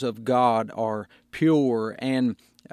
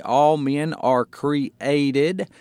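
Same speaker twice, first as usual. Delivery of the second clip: "all men are created" drawn out very slowly; slow emphatic; much slower than usual. Shown as a demonstration. Pastor stressing created to the congregation, slow. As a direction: spell it out word by word. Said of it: "That word created there is important".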